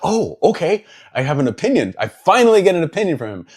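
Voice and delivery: high, loud burst of mock-amazed excitement; re-enacted excitement. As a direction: act out eager surprise at finally getting an answer. Re-enacts delight at finally getting opinion.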